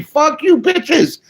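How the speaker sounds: high, punchy taunt; defiant shout. Real, not acted. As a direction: fire it off at the haters. Defiant outburst.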